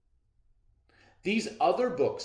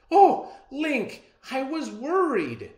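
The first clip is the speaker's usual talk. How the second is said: high, loud outburst; relieved worry; 9 dB louder, slower than usual. Acted. Mother hugging daddy after the storm, relieved cry. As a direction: let the worry pour out, high-pitched.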